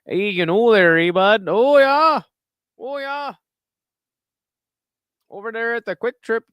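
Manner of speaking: cartoonish upper Midwest Wisconsin accent; mock Wisconsin accent. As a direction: play a friendly Wisconsin local, eh bud. Mock folksy Midwestern local banter.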